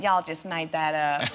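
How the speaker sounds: slow imitated Southern drawl; Midwest Southern accent. Local doubts the actress's film, slow Southern accent. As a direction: imitate a disbelieving Kansas local, slowly. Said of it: "sounds a little bit Midwest and Southern".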